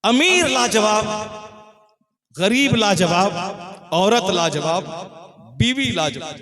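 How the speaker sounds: high, loud chant-like list; loud proclamation. Real, not acted. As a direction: hold the first word of each, then pause. Preacher proclaiming to the gathering.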